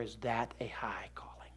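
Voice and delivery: hushed, slow, breathy echo of the question; whispered repeat. Shown as a demonstration. Preacher repeating his question to the congregation in a slow whisper. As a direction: drop to a whisper to drive it home. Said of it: "rhetorical repeat, whispered".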